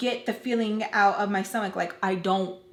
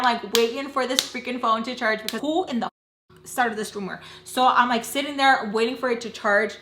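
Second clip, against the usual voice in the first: ordinary delivery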